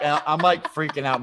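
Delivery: ordinary talk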